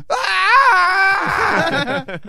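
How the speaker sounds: sudden high shriek; scream. Retold or imitated. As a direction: scream right into the phone. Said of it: "I scream".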